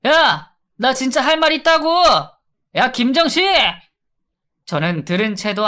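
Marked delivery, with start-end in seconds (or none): 0.1-3.5 high, loud shout from behind the door; yelling. Acted. Locked-up inmate yelling after the departing guard, loud shout. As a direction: bellow the guard's name through the cell. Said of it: "고래고래 소리를 지르더라고요".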